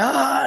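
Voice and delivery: very high drawn-out gasp of alarm; mock gasp of panic; panicked gasp. Poisoned contestant hearing the medic's question, high panicked gasp.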